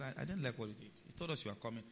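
very soft, low-key objection; calm complaint. Retold or imitated. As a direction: voice displeasure quietly, without heat. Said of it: "I've always been very calming".

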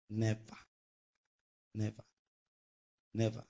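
low, soft, spaced-out repeats; solemn denial. Real, not acted. Preacher denies the born-again spirit is depressed, solemn. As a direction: let each one drop quietly.

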